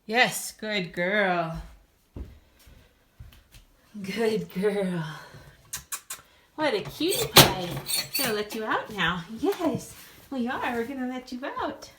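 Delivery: squeaky praising a dog. Real, not acted. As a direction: coo while letting the puppy out. Letting puppy out.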